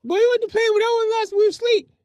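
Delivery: high-pitched, loud emergency yell; frantic shouting. Retold or imitated. Parent demanding water for the fire, frantic shouting. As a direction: imitate a parent yelling in the chaos.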